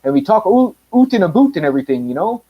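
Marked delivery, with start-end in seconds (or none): 0.4-1.6 exaggerated Canadian "oot and a boot"; Canadian accent. Shown as a demonstration. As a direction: ham up the Canadian vowels. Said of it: "all we do is sound Canadian".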